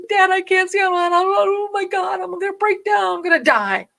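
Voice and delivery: high, loud panicked pleading; crying out that she's about to die. Retold or imitated. Driving blind at night, begging dad in panic. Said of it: "I'm like".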